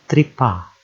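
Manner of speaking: tripa run together as one word, falling at the end; joined syllables. Shown as a demonstration. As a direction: join TRI and PA without a gap. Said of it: "two syllable words".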